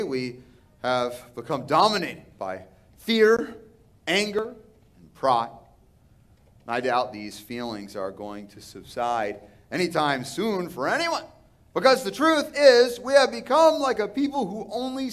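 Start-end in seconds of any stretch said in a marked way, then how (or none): none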